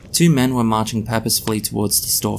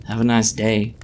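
usual speaking voice

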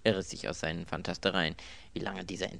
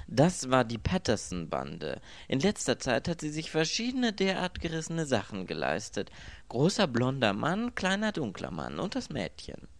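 raised, emphatic detective voice; theatrical inspector; 6 semitones higher, 4 dB louder than usual. A narrator's reading. Inspector naming the gang to the victim, theatrically sure. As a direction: rattle off the gang with confidence.

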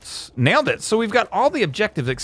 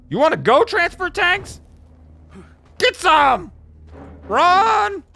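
high, hammed-up fighting yell; playful war cry; mock battle challenge; slower than usual. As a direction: taunt the tanks, rising to a high shout. Challenging transfer tanks, then attacking.

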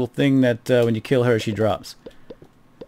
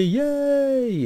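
high, held, sarcastic yay; a sing-song fake celebration; drawn-out high-pitched mock hooray; mock cheer.

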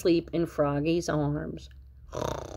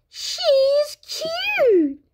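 very high, squealing admiration; gushing squeaky little character; Frogalina; 4 dB louder than usual. Acted. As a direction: coo it way up high, drawn out. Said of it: "said Frogalina".

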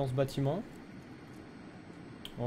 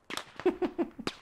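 high laugh; 6 dB quieter than usual. Real, not acted. Laughing at teammate's fall.

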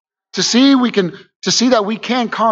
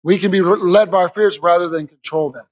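usual speaking voice

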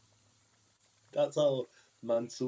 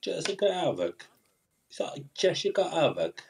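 higher-pitched put-on British accent; badly faked British, pitched up; mock British accent; 4 semitones higher than usual. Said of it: "That's not what British people sound like".

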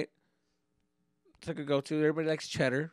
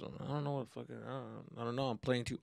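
usual speaking voice

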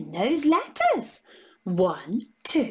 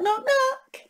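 normal talking voice

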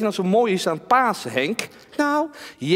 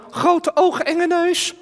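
high, scared puppet voice; nervous ventriloquist doll; talking doll; 8 semitones higher, slower than usual. Acted. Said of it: "Henk is mijn pratende pop".

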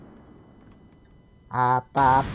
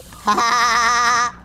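loud, higher "ha-ha-ha"; staccato cackle; 4 semitones higher, 4 dB louder than usual. Pretend. Gamer laughing at the game, staccato cackle. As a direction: burst out in a loud, rapid cackle. Player laughs loudly while gaming.

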